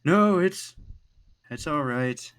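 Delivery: high, loud, forced it's-fine; dejected sigh. Acted. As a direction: brush it off with a sigh. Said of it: "lets out a sigh, and he goes".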